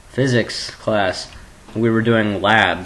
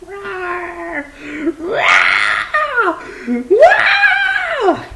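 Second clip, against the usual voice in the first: very high mock roar, building louder; squeaky werewolf roar; 4 dB louder, much slower than usual. Vlogger pretending to be a werewolf, squealed mock roar. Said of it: "Maybe I'll be a werewolf again".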